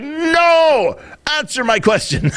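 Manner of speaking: high drawn-out refusal, then a demand; imitating pissed reporter. Reporter refusing to be passed over, pissed. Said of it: "he was pissed".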